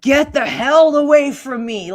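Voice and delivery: high, loud mock shout; retold panicked yell. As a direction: yell it as if scaring someone off.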